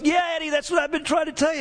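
high-pitched, eager outburst; excited interjection. Retold or imitated. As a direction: jump in as the excited dad, voice way up. Dad's excited interjection, retold.

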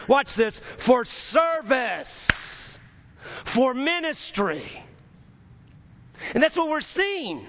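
high, driving pulpit cadence; full-force preaching. Real, not acted. Preacher urging the congregation, high and driving. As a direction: preach it up to the rafters.

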